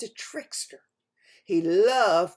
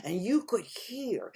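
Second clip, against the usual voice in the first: ordinary delivery, nothing marked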